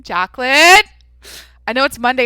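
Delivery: sing-song call of Jacqueline to rein herself in. Real, not acted. Telling herself to calm down, Jacqueline called out loud and rising. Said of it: "calm down".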